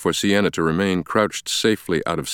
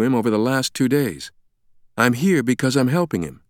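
higher-pitched, composed explanation; take-charge woman doctor; 6 semitones higher than usual. Acted. Sienna explains Langdon's amnesia to Ferris, taking charge. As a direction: step in and take control.

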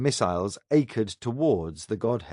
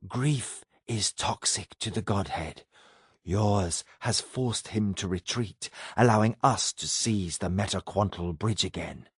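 a cold cosmic being speaking; alien entity voice; 4 semitones lower, 5 dB quieter than usual. Acted.

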